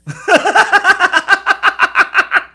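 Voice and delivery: real laugh, loud and high; a big squealing laugh; high burst of laughter. Real, not acted. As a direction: crack up at your own joke. Laughs at own bit.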